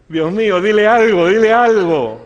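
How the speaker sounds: loud, high cry to God; acted-out begging prayer, shouted; desperate plea. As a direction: plead urgently at full voice.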